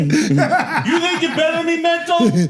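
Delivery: high, slow, laughing put-on voice; mock tough guy. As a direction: ham up a comic accent. Mock tough guy accent bit.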